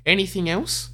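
high probing question; voicing the lady. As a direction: press her question brightly. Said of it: "The lady probes".

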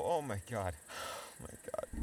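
fading oh my god. Acted. Pain, fading to breath.

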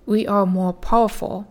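normal talking voice

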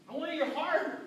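put-on Jesus telling the rich young ruler he wants his heart; Jesus insisting. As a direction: proclaim it as Jesus would.

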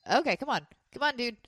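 normal talking voice

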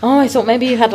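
ordinary talk

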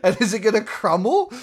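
high, loud, fast sarcastic outburst; mock the lazy business name, incredulous; sarcastic rant. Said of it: "And it's like".

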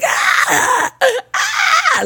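shrill imitation of a crying infant; imitated baby wail. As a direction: wail like a fussy newborn. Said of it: "like, this annoying ass cry".

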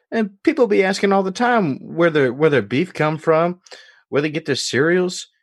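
put-on Jerome's Deep South accent. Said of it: "that's the accent".

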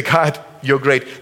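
drawn out slowly, reverent; slow and earnest. Shown as a demonstration. Preacher slowly showing the church how to praise. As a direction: savor each word of praise. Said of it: "to stand and to say".

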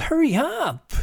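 very high, loud, impatient call. A narrator's reading. Boy hurrying his father along, calling out. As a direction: holler it to hurry someone along. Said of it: "Billy called".

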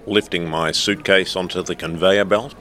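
ordinary delivery

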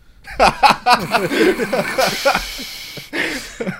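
loud laughter. Real, not acted. Hint reveal, big laugh.

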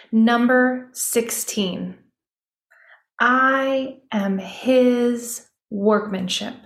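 slow, deliberate reading of the verse; slow declaration. Acted. Host reading the declaration to listeners, slow and deliberate. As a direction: declare it slowly, word by word.

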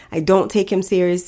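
ordinary talk

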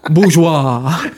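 hammed-up French word; mock French accent. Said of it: "you got any French accents".

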